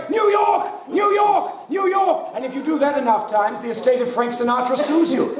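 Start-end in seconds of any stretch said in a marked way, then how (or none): 0.1-2.3 shouting New York. Retold or imitated. Mock crooner yelling the city to the crowd. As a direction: belt it like a star on stage. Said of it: "the equivalent of me coming, going".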